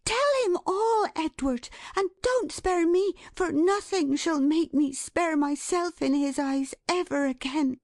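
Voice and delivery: high, insistent pleading; impassioned cry. A narrator's reading. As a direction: demand the full truth in a high voice. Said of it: "cried Dot".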